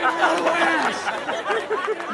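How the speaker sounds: high, strained mock scream. Dad screaming on the toilet, drawn-out mock scream. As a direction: drag it out as a hammed-up scream. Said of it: "Man just screams shit".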